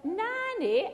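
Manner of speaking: high-pitched retold child's call; lightly mimicked little girl; imitated granddaughter. Voices granddaughter using wrong name.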